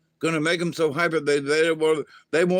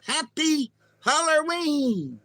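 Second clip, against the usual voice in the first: put-on child voice; 13 semitones higher, slower than usual. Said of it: "the kid goes".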